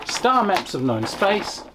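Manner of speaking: ordinary talk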